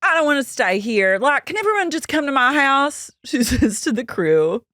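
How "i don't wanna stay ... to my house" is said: high-pitched mock distress; hammed-up, high retold appeal; imitating her upset plea. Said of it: "She goes".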